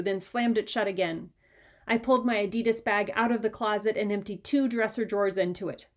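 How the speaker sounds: ordinary delivery, nothing marked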